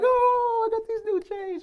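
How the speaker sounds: very high, showing-off squeal; high gleeful brag to friends; bragging to friends. Retold or imitated. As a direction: crow to the homies. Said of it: "I turned to the homies and I'm like".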